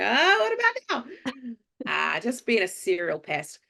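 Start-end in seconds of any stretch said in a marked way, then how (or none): 0.0-2.1 high, cheeky teasing. Retold or imitated. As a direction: pester them playfully. Teasing pestering quote.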